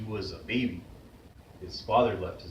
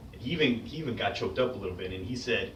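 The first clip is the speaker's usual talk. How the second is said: normal talking voice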